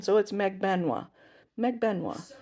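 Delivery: Magbanwa in three syllables, wa gliding at the end; chosen pronunciation. Shown as a demonstration. Trusting the relative's Filipino pronunciation, three syllables. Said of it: "she is from the Philippines".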